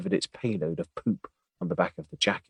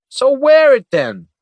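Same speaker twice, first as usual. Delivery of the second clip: very loud, high, slowed retort; exasperated; 12 dB louder, slower than usual. Acted. Weiss fed up with his whining partner, exasperated. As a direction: lose patience with him.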